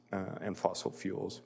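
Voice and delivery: usual speaking voice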